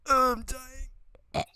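high-pitched groan of disgust; agonised wail. Real, not acted. Host suffering from Vegemite, high agonised moan. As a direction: wail it as if the taste is killing you.

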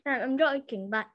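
normal talking voice

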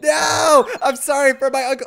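loud, high mock panic; sudden cry of mock horror; mock shout. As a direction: yelp it out in fake alarm. Mock panic at game character.

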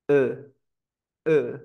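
"eh" as a relaxed, neutral schwa; schwa demo. Shown as a demonstration. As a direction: say "eh" with the tongue completely relaxed. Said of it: "cómo se hace la schwa". ("eh" como schwa, con la lengua relajada)

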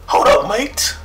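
high, loud hammed-up British accent; mock British accent. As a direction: play a jokey Brit. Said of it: "I got the wrong accent".

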